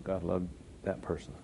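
"God loved that person" said softly, falling; soft repeat. Acted.

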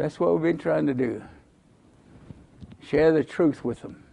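usual speaking voice

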